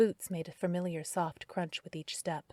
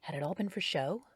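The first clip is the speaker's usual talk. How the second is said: plain reading voice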